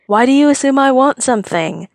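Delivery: high, wide-eyed mock offense; mock hurt. A narrator's reading. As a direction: play wounded innocence. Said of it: "all big-eyed, mock hurt".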